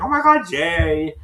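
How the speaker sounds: high, loud, drawn-out mock squeal; overcome celebration. Retelling sisters' reaction to the test result, overcome with joy. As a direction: imitate an overjoyed, drawn-out cheer. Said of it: "they're coming so hard".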